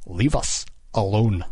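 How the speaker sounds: slow, curt command; cold and brusque. A narrator's reading. King dismissing the warden, cold and curt. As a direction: dismiss him with icy authority. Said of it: "A cold, brusque voice".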